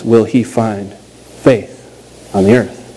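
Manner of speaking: slow, weighty preacher's question; preaching. Acted. Pastor to his congregation, slow and solemn.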